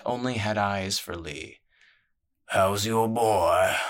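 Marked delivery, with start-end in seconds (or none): 2.5-3.8 slow, louder probing question; slow menacing crime boss. A narrator's reading. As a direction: ask kindly but loaded. Crime boss voiced in story.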